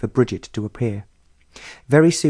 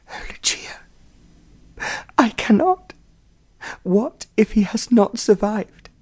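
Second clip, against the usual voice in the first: a delicate lady near fainting; frail distraught mother; 10 semitones higher than usual. A narrator's reading. Distraught mother voiced by narrator.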